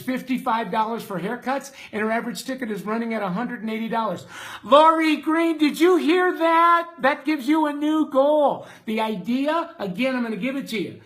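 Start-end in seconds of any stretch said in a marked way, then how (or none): 4.6-8.7 higher, louder calling out to a viewer; excited call-out. Real, not acted. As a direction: call her name across the room, thrilled.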